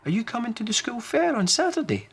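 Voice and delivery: put-on high, eager little girl voice; a polite child offering a seat; high child voice asking kindly; young girl Emily. Emily asks.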